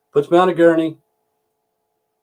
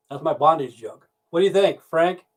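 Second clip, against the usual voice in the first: usual speaking voice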